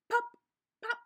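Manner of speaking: ordinary delivery, nothing marked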